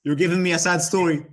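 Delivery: loud, high mock-hurt teasing; hammed-up sad protest. Real, not acted. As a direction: pretend to be wounded, laughing. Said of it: "You're making me cry".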